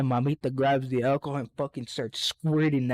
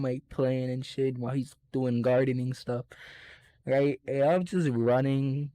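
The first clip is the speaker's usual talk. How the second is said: ordinary delivery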